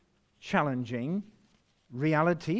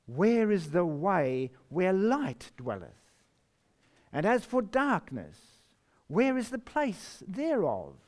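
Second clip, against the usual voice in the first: slow, solemn reading; scripture reading; 4 semitones higher than usual. Acted. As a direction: let WHERE ring out. Reads verse nineteen.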